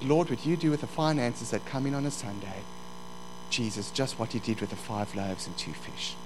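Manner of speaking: soft, low, humble prayer; hushed, simple petition to God; retold prayer. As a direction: pray quietly, voice dropping toward the end.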